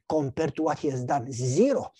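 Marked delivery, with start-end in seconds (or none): none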